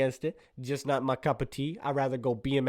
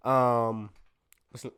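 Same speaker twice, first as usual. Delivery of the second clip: ordinary talk, nothing marked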